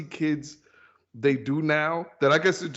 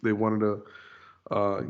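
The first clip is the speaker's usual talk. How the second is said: usual speaking voice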